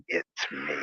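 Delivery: low, hushed mock-sinister "It's me.". Shown as a demonstration. Greeting the mail lady at the door, mock sinister. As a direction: creep the words out low and soft, as a playful menace. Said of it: "kinda a sinister, like".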